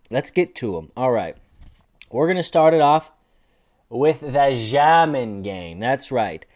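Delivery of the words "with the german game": slow, drawn-out fake German accent; hammed-up German pronunciation; mock German accent. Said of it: "I can't do a German accent".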